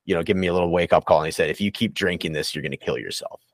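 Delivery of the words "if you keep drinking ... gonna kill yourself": a hint of an old man warning gravely; grandpa's warning voice. Inner voice like her grandpa, warning her: lower, softer stern warning. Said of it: "maybe my grandpa".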